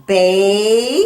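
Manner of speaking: "Bay" loud, the A held and rising; long A sound. Shown as a demonstration. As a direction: stretch the vowel of "Bay" so the A rings out. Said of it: "You hear the A?".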